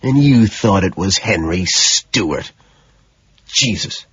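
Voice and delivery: slow contemptuous sneer. Acted. Killer sneering down at his victim, slow contempt. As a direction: lean in and sneer the words. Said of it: "leaning into her sneer".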